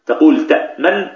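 normal talking voice

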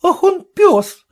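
indignant, rising outcry; indignant old woman's outburst. A narrator's reading. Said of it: "негодовала Анна Степановна".

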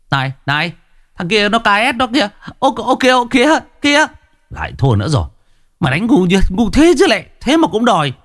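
high, fast gamer outbursts; excited gamer yelling. Acted. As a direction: shout at the screen over the match. Youths shouting at net café game. (đám thanh niên la hét khi chơi game)

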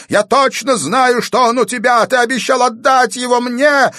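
very high, loud wailing demand; madman's yell. A narrator's reading. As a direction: yell it like an escaped lunatic. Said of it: "продолжал вопить сумасшедший". (истошный вопль безумца)

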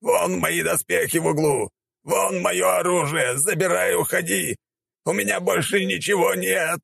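high, drawn-out pleading; hammed-up high-pitched begging; desperate surrender. A narrator's reading. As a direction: give it all up in a high wail. Warrior wails, surrenders everything.

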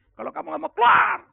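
high, explosive shout imitating dad; angry father. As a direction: blow up like a father throwing his kid out. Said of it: "Bapaknya marah". (menirukan bentakan bapak marah)

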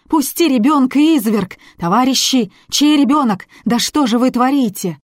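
outraged shouting. A narrator's reading. Woman shouts at the guard.

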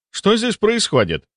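high-pitched, questioning newcomer; puzzled authority. A narrator's reading. Chief doctor walks in on a frozen scene, high questioning voice.

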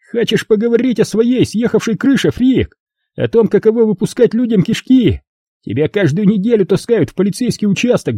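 high, heated bully tirade; Rob yelling. A narrator's reading. Rob shouting at John about his craziness, heated high tirade. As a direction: let it build into a yell. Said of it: "Он сорвался на крик".

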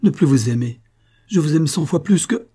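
impassioned outburst, breaking off unfinished; fervent exclamation. A narrator's reading. Servant protesting love to her mistress, fervent exclamation. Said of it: "s'écria Monique".

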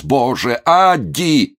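high, loud anguished cry; full-throated yell. A narrator's reading. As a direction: call out to her in despair. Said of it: "заорал он во всю глотку". (отчаянно орёт во всю глотку)

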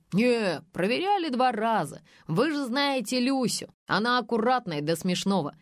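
loud booming reply. A narrator's reading. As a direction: boom it out, sure of yourself. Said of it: "загудел Павлов". (гудящим голосом)

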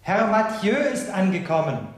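high, loud announcement; formal herald call; announcing. Acted. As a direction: call the arrival into the room. Servant announces the guest.

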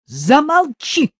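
high, loud, drawn-out scolding; snapping at him. A narrator's reading. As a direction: shut him up sharply. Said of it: "прикрикнула на него мать".

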